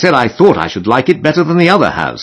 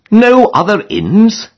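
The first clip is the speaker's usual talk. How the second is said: high-pitched, drawn-out exclamation of disbelief; exclaimed question; 12 semitones higher, 4 dB louder, slower than usual. A narrator's reading. Harris reacting to word of only two inns, exclaiming. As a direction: exclaim it slowly, pitched right up. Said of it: "exclaimed Harris".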